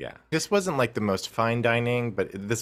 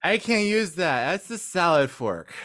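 put-on slow, slurred drunk voice; drunken slur; 7 semitones higher than usual. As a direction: slur it like you've had wine. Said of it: "I drunkenly slur".

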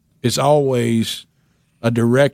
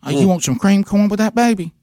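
much higher, sweet and doting; waitress voice; 8 semitones higher than usual. Retold or imitated. Waitress offering fried chicken to caller, high and doting. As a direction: dote on him like a favorite customer. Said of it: "hear her voice saying to me".

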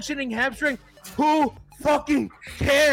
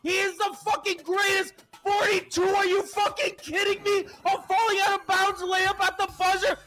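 ecstatic shouting rant; 7 semitones higher than usual. Real, not acted. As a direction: scream it at every doubter. Victory rant at doubters.

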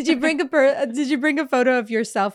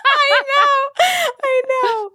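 much higher, louder, through laughter; laughing hard; 7 dB louder, much slower than usual. Real, not acted. Laughing at her friend's episode joke, high-pitched giggle. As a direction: squeal it out while cracking up.

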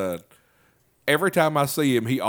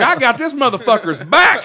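excited; 11 semitones higher, 8 dB louder than usual. Retold or imitated. Excited retold thought.

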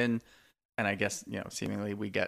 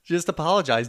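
high, loud laughing protest; laughing exasperation; 6 semitones higher, 9 dB louder than usual. Real, not acted. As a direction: laugh it out in disbelief. Mocking bad apology, laughing.

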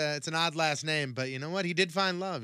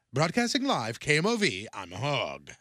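on-air reporter. Retold or imitated. Host imitates a new field reporter going live, reporter sign-off. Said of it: "she did a report ... she was like".

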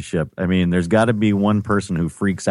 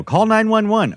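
urgent command; 12 semitones higher, slower than usual. Retold or imitated. First aider ordering bystanders to call for help. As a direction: point and bark the order. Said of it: "point at people and go".

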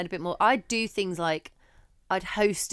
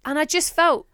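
ordinary talk, nothing marked

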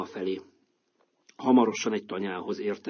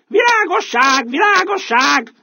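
loud high shout, slow; rooster's cry; 12 dB louder, slower than usual. Acted. Rooster spotting light in the woods, shouting loud. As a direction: cry out the discovery to the others. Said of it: "Kiáltott fel egyszer csak".

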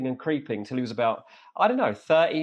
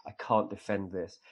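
softer, quietly let down; resigned apology; 5 semitones lower, 8 dB quieter than usual. Retold or imitated. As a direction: sigh it out like a disappointed fan. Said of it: "was just like".

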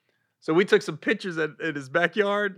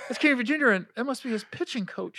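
clueless onlooker; 7 semitones higher, 3 dB quieter than usual. Retold or imitated. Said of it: "they were saying that".

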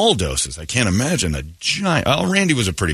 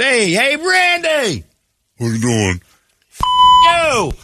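high, loud taunting call; mock heckling yell; 5 dB louder, slower than usual. Fan heckling Randy Johnson, loud mock yell. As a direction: yell across the crowd to rattle him.

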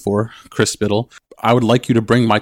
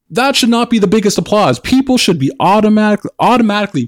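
much higher, louder indignant plea; passionate outburst; 11 semitones higher, 4 dB louder than usual. Real, not acted. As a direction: raise the pitch in outrage. Impassioned rant on identity.

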